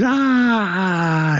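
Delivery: short high mock yelp; startled yell as rats jump on him; mock scream. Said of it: "and he's".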